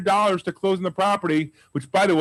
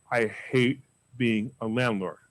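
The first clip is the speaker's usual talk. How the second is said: imitating a weary seller, lower, softer and slow; 5 semitones lower, 5 dB quieter, slower than usual. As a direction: drag it out like a tired landlord. Seller voiced in retold deal.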